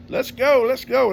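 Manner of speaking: high, loud eager calling; shouted let's go; impatient call. Retold or imitated. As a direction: hurry everyone along, loud and high. Sons rushing home from boat.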